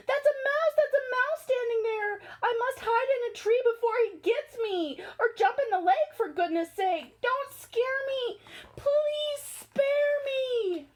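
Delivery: high, panicky pleading, slowing at the end; panicked elephant. Acted. Elephant terrified of the little mouse, high panicky begging. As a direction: beg the mouse to spare you.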